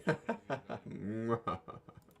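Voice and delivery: loud theatrical villain laugh; triumphant hammed-up cackle; mad scientist laugh. Okabe's grand laugh after announcement.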